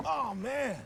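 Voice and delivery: breathy airy laughter with a whoop; laughing. Acted. Host laughing at his guest's arrival, delighted. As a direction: laugh it off with a cheer.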